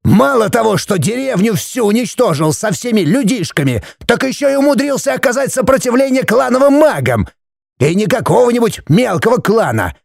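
high-pitched, strained exasperation; angry tirade. Acted. Father listing his son's misdeeds, angry. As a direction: pile up the charges in outrage.

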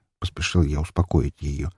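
ordinary delivery

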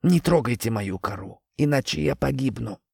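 quiet, distant tree voice; a creaky old tree pleading softly; talking tree. Acted. Tree character voice. (говорящее дерево)

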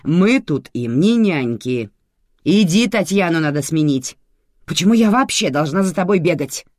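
exasperated dressing-down; irritated boss. A narrator's reading. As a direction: scold a subordinate impatiently. Boss scolds employee.

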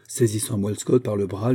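plain reading voice

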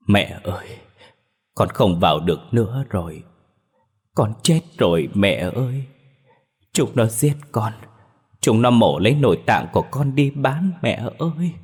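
halting tearful wail, rising in pitch at the end; sobbing, sniffling. Acted. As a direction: sob it out to mother, phrase by phrase. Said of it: "khóc nấc từng tiếng, cất giọng thút thít". (khóc nấc, giọng thút thít kêu mẹ)

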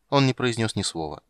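ordinary delivery, nothing marked

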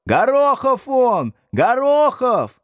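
very high, drawn-out yells; back-row yelling. A narrator's reading. Back desks confirm the surname to teacher, yelling. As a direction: holler the name from the back of the class. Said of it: "заорали с задних парт".

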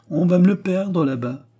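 whining lament. A narrator's reading. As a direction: imitate her moaning complaint. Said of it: "geignait-elle du matin au soir". (en imitant ses geignements)

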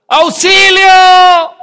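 desperate shout for help. Retold or imitated. Trapped under the truck, screaming for a neighbor, desperate full shout. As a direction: yell for help with everything you have. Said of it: "empecé a gritar, desesperado".

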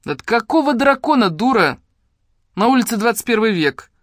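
put-on loud, high, irritated old woman; snapping back. A narrator's reading. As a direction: snap scornfully at a fool. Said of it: "огрызнулась бабка".

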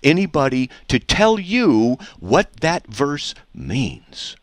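very slow, word by word; drawn-out, deliberate interrogation; pointed questioning. Real, not acted. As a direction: spell the question out for a dodging opponent. Pressing a repeated, unanswered question.

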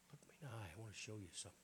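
tender Jesus voice. Acted. Preacher voicing Jesus inviting a believer, softly and tenderly. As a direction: speak it close and gentle, like an invitation.

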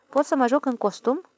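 a touch of crafty challenge; sly. A narrator's reading. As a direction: ask with mock innocence, perfidiously. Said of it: "întrebă el perfid".

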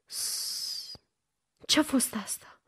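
hushed, whispery question after a shush; shush, then whisper it urgently; alarmed hush. A narrator's reading. Girl hears a noise downstairs, alarmed hush.

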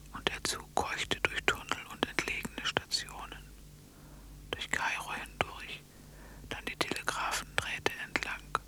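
hushed, breathy storyteller whisper throughout; whispered narration. A narrator's reading. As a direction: whisper the tale like a secret at bedtime. Whispered fairy-tale narration.

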